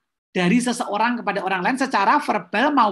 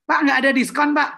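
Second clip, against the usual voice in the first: curt refusal; 5 semitones higher, 4 dB louder than usual. Shown as a demonstration. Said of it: "itu nonverbal".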